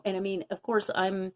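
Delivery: ordinary talk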